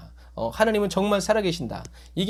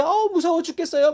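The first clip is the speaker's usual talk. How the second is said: higher, whiny mock fright; mock fear; 10 semitones higher than usual. Preacher mimicking a fearful believer, mock scared whine. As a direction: ham up a scared believer's wail. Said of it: "막 이러면요".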